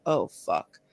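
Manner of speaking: low, soft sinking realisation; quiet dismay. Retold or imitated. Friend realising her teammate's dad is here, quiet dismay. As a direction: drop it low and soft as it sinks in. Friend grasps the bad news.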